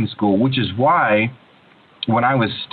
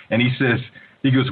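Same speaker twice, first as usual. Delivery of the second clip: ordinary talk, nothing marked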